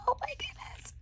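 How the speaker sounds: very high, soft whisper; awed whisper. Real, not acted. Crafter marvelling at a tiny bag, awed whisper. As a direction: breathe it in awe.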